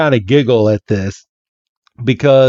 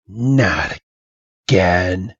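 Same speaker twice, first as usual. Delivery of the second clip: weary sigh; 3 semitones lower, 3 dB quieter, much slower than usual. Retold or imitated. Old man dismayed.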